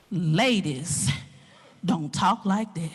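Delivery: low, slow reprimand; deep-pitched, deliberate teacher voice; stern coach. Retold or imitated. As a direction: imitate a coach's measured scolding. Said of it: "he stopped me and he said".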